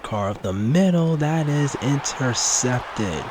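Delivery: shocked play-by-play as the pass is picked; surprised interception call. Real, not acted. Interception called live.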